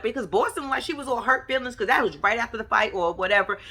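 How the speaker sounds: usual speaking voice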